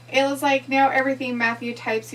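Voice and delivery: ordinary delivery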